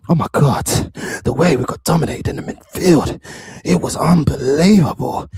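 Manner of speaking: low, loud, put-on breathy voice; hammed-up low, airy dismay; mocking pundit imitation. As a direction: mimic an overdramatic football fan, dragging the ending. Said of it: "Who talks like that?".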